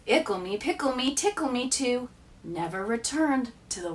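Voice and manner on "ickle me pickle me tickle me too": usual speaking voice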